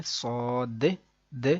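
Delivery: صاد with its د released as a short echoing "deh"; bounced final d. Shown as a demonstration. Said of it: "یہ ایک sound نکالا میں نے". (صاد کی د ہلا کر)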